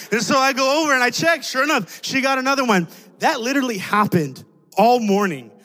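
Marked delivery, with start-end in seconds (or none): none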